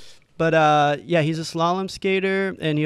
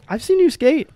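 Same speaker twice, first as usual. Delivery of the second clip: mock starstruck fan; 10 semitones higher, 3 dB louder than usual. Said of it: "And I'm like".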